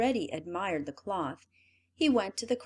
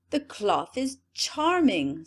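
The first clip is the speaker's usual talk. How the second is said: slow, loud, put-on admiration; a pompous emperor bluffing; forced praise aloud; 2 semitones higher, 5 dB louder, slower than usual. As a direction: fake delight to hide his blindness. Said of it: "said he aloud".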